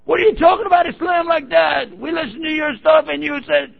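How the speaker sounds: high, loud, indignant shout at the DJ; angry caller. Retold or imitated. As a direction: yell it like an offended caller demanding answers.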